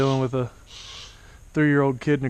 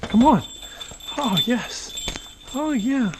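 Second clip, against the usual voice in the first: excited; 8 semitones higher, slower than usual. Real, not acted. Bird finally comes to glove.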